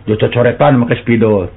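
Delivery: ordinary delivery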